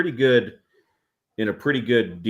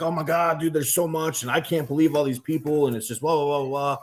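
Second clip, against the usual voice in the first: higher-pitched mock panicked rambling; imitated freak-out; 7 semitones higher than usual. Competitor imitating Corey overwhelmed by the turnout, mock freak-out. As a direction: babble on like someone overwhelmed. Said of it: "Corey's freaking out".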